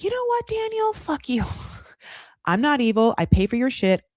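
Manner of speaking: high mocking snap at Daniel; Lisa's outburst. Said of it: "Lisa was like".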